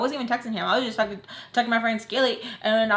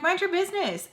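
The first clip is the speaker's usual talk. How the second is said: high-pitched, sassy telling-off; sharp rebuke; 9 semitones higher than usual. Retold or imitated. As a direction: snap it high in one quick burst. Retells snapping at Sam, first take.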